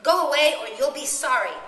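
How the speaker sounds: loud, high warning shout; sharp threat. A narrator's reading. Coraline warns the thing outside, loud and sharp. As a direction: threaten it hard. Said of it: "said Coraline sharply".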